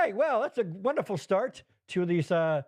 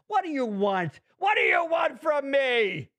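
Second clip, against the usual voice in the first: exasperated shout; 6 semitones higher than usual. Acted. Exasperated outburst at co-host.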